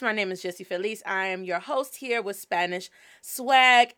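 ordinary delivery

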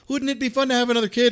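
high, fast, lightly voiced wife; mock sing-song wife. Imitating the wife's ask to her husband, high and fast. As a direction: toss it off brightly, imitating. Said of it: "she kinda does this".

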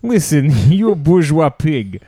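slow, hammed-up Russian accent; heavy mock Russian accent, drawn out; John McCain. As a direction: do a silly Soviet Russian voice. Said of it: "Can you do Soviet John McCain".

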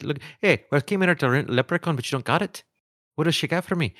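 high, lilting mock Irish customer; Irish accent. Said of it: "talking like this and they're like".

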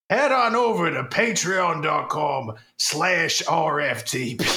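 hammed-up British kid ad read, drifting; British Donovan Potter accent. Sponsor spoof ad as Donovan Potter, British accent. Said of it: "make me accent inconsistent".

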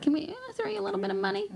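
high, coaxing request; high sly fixer voice. Retold or imitated. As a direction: wheedle like a shady fixer. Fixer voiced asking Italy.

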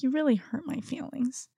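whispered imitation of a hurt child. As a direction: whisper it like a bedtime confession. Retells child's bedtime confession.

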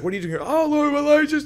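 high, frantic mock agitation; shaking someone up. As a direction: blurt it out as if shaking him hard. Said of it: "he's shaking him up".

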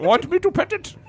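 put-on high old-timer voice; old prospector voice. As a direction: repeat it as the prospector. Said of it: "walking around town saying".

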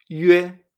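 yue said "yüe", with French U; loud, clear yue with Ü before E; Y con diéresis. Shown as a demonstration. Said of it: "y con la Y". (yue con U francesa)

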